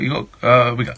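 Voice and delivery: normal talking voice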